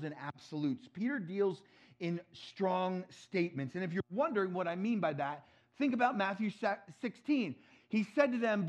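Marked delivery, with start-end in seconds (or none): none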